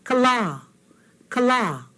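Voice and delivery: Kala with the second ah stretched long; Kala, short first vowel, long final ah. Shown as a demonstration. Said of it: "listen to the word for the sun".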